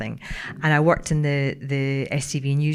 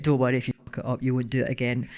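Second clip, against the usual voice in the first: low, fast, offhand gruff boss; 4 semitones lower, 4 dB quieter, much faster than usual. Retold or imitated. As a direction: imitate a gruff man's dry reassurance. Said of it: "he was a very gruff man".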